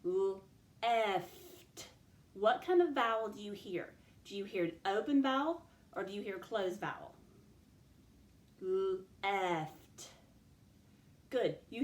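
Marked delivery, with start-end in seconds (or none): 0.0-1.9 "Left" in long separate sounds, l-e-ft; stretched out. Shown as a demonstration. Teacher has pupils listen for the vowel, "Left" stretched out. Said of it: "I want you to listen for the vowel". 8.6-10.1 "Left" in long separate sounds, l-e-ft; stretched out. Shown as a demonstration. Teacher has pupils listen for the vowel, "Left" stretched out. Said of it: "Let's stretch that one out".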